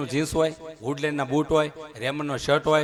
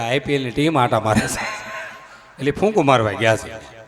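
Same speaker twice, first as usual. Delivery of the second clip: loud punchline; 3 semitones lower, 6 dB louder than usual. Real, not acted. Punchline shouted to crowd.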